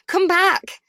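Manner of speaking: desperate full-voiced shout. Ellie yelling after the woman taking her baby, desperate shout. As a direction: yell after the fleeing woman.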